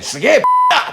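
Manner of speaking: high, loud mock-irritated yell; irritated outburst. Teacher retelling his retort to the student's questions, irritated yell. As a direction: snap it out loud, acting fed up. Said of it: "もうこっちもイラっときたんでね".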